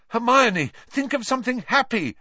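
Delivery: high, loud, hurried cry; shouted, urgent, high-pitched plea; urgent yell. A narrator's reading. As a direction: yell it quickly to a friend in danger. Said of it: "Harry yelled".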